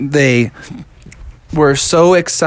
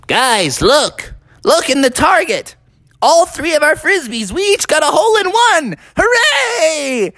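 excited discovery; 12 semitones higher than usual. Acted. Possum showing friends their holes in one, thrilled. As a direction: build from look to a hooray.

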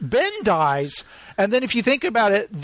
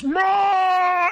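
Chewie roar imitation; 12 semitones higher than usual. Imitating Chewie crying out in grief. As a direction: mimic Chewie's anguished cry. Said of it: "when Chewie goes".

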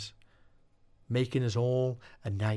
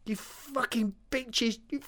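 furious scream; 11 semitones higher than usual. Acted. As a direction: hurl it at them, hitting bitches. Dave tied up, cursing the witches.

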